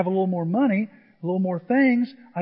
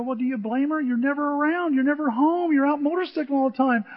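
higher-pitched, insistent reproach; retold scolding; 6 semitones higher than usual. Preacher scolding his divorcing brother, reproachful. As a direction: lay the blame on him, rattling off his faults.

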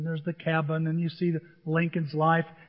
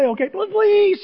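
high, loud imitation of begging; drawn-out, hammed-up please; interrupting with a big mock plea; mock pleading; 13 semitones higher, 9 dB louder than usual. Acting out child's repeated please.

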